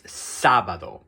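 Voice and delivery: "sábado" with extra weight on the first á; stress on first A. Shown as a demonstration. Teacher models the accent mark for students, stressed first syllable in "sábado". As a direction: lean slowly on the opening syllable of "sábado". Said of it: "put extra stress on that first A".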